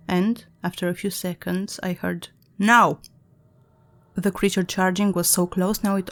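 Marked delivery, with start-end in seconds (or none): 2.6-3.0 sudden loud call; shouted. Acted. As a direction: burst out with it. Alex springs the trap.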